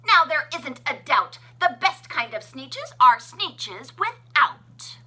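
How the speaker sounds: loud, high proud chant; haughty crowd shout. A narrator's reading. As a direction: shout it with snoots in the air. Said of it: "they let out a shout".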